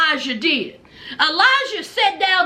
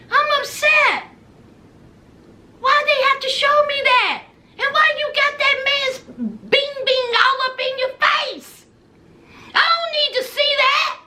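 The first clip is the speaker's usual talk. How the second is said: upset Gino voice; 6 semitones higher than usual. Retold or imitated. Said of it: "Gino was like".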